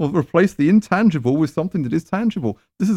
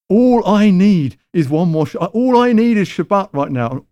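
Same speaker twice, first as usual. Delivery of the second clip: higher, louder whiny demand; mock self-centred believer; 5 semitones higher, 5 dB louder than usual. Preacher mocking a worshipper demanding Shabbat, higher and louder whine. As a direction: mimic someone who wants it all now. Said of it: "You've decided you need".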